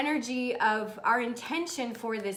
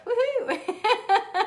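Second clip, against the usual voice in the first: high, laughing woohoo; delighted whoop with a laugh; cheer; 9 semitones higher than usual. Real, not acted. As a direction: whoop for joy. Cheering a book order.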